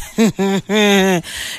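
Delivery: low, soft chuckle. Real, not acted. Host reacting to a listener's message, chuckling. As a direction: chuckle quietly to yourself.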